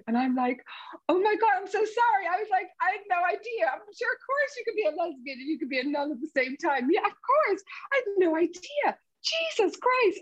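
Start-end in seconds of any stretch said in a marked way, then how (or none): 1.1-10.2 flustered apology. Retold or imitated.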